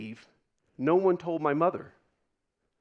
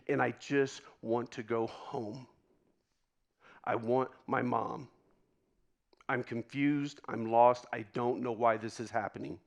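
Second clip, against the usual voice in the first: quieter, lower, homesick recollection; subdued despair; 4 semitones lower, 6 dB quieter than usual. Retold or imitated. Man reliving being stranded as a boy, quietly forlorn. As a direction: sink into a small, lost plea.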